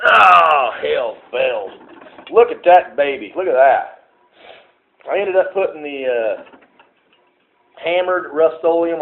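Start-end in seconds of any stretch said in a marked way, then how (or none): 0.1-1.7 startled. Real, not acted. Startled by the lantern. 2.3-4.1 delighted. Real, not acted. Admiring the painted cage.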